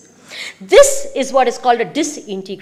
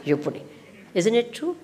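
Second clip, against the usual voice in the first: ordinary talk, nothing marked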